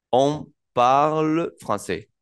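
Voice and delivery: "On parle français" pausado y descendente; francés lento. Shown as a demonstration. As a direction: cierra el ejemplo despacio. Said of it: "conjugar el verbo en la tercera persona". (profesor repite el ejemplo despacio)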